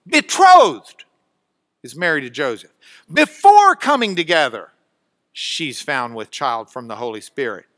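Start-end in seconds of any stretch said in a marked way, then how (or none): 0.1-1.1 "Betrothed" very loud and high, second syllable stretched; emphatic single word. Shown as a demonstration. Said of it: "listen to the drama". 3.1-4.7 "before" very loud, second syllable punched; emphatic opening word. Shown as a demonstration. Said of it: "listen to the drama".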